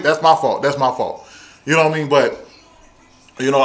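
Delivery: ordinary delivery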